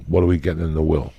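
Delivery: fast, blunt follow-up; deadpan quick question. Retold or imitated. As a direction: drop it fast and straight. Punchline after fake sympathy.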